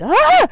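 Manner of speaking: shrill high shriek; scream of fright. Retold or imitated. She shrieks as the spider stares at her. Spider runs to other hand.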